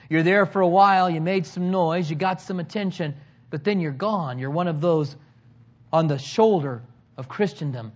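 ordinary talk

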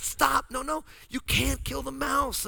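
high, urgent pleading. Retold or imitated. Husband voicing his wife begging him to spare the mouse. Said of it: "she's like".

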